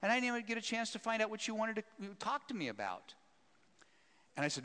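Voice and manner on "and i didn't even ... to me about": higher, flustered and gushing; surprised how late it got, pour out apologies; a lively retold woman, much higher; gushing apology. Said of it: "she looks at her watch and she goes".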